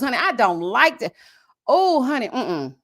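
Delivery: usual speaking voice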